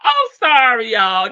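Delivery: laughing apology, loud and high; can't stop laughing while saying sorry; being tickled. Real, not acted. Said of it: "That tickled me".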